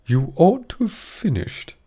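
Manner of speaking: high, slow reproving voice; a king scolding the late Hatter; king's rebuke. A narrator's reading. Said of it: "said the king".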